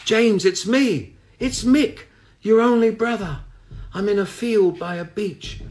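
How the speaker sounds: a lost soul calling from a cold field; ghostly brother's voice. A narrator's reading. The dead brother tells the priest where he is, high and fading. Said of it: "reedy and thin like an evaporating mist".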